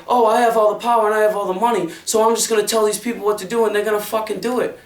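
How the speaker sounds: fast, smug imitation of people with money; mocking the powerful. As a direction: sneer it like a rich boss giving orders. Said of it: "you fucking think by".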